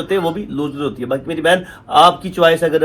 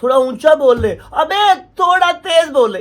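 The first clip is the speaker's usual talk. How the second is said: mock annoyed viewer; 9 semitones higher than usual. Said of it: "आप लोग खुद ही बोलोगे".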